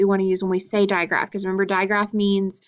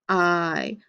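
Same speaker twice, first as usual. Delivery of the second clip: low voice; 3 semitones lower than usual. Shown as a demonstration. Vowel said low against consonants.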